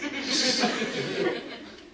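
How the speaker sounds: an airy laugh mid-joke; breathy laugh. Real, not acted. Comic laughing at his own funeral joke. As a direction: let out a breathy chuckle.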